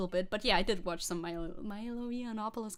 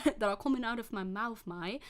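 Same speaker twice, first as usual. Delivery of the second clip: ordinary delivery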